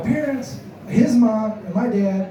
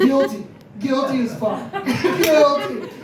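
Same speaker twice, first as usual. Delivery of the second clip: laughing; 5 semitones higher than usual. Real, not acted. Laughing self-description.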